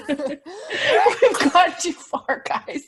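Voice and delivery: slow, through laughter; laughing. Real, not acted. Laughing wrap-up.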